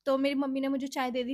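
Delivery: ordinary delivery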